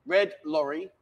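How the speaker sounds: red lorry said slow, word by word; slow and careful. Shown as a demonstration. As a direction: spell out each word slowly. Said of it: "Go for that".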